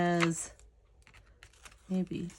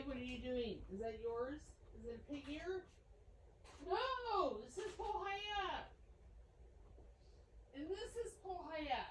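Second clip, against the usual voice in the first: high, hushed cooing at the dog; high soft pet voice to the dog; dog talk; 6 semitones higher, 18 dB quieter than usual. Real, not acted. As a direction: sweet-talk the puppy softly.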